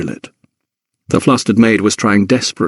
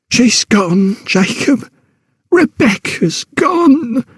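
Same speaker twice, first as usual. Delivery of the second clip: much higher, slow, sobbing grief; sobbing wife; 14 semitones higher, much slower than usual. A narrator's reading. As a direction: break down sobbing, drawn out and high. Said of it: "she sobbed".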